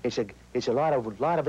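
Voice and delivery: breathy gangster snarl; airy tough-guy growl; Jimmy Cagney. Shown as a demonstration. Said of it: "the breathy type voice, which Jimmy Cagney would have".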